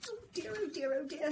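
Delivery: high, fretting oh dears; fretful dismay. A narrator's reading. The crow frets about flying up, high and fretful. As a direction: wring your hands in worry.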